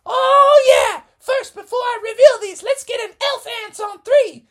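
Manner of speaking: high, squeaky cheerful mom voice; giddy team mom. Acted. Missus Frogerson cheering the boys on, high and giddy.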